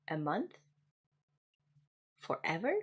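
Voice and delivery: ordinary talk, nothing marked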